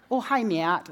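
put-on light upper Midwestern accent, Matt in two syllables as Ma-att; a mild upper Midwestern mother-in-law greeting; imitating a Minnesota mother-in-law's upper Midwestern Ma-att. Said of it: "she pronounces my name with two syllables".

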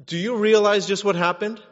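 ordinary talk

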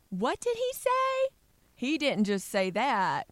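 high, slow sassy disbelief. Pretend. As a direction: play it as shocked, sassy gossip. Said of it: "Or you could say".

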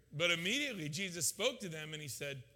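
usual speaking voice

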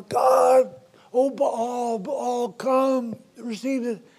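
lightly voiced frantic prophets calling on Baal; pleading prophets. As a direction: wail it like desperate idol worshippers.

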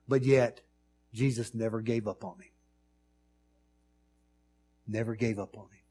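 usual speaking voice

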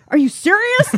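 high, loud mock disbelief; incredulous. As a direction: blurt out your disbelief. Disbelief at the poem.

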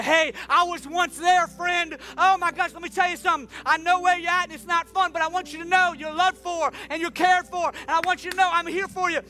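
high, loud, fervent sermon voice; full-force preaching. Acted. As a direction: preach it out to the whole room.

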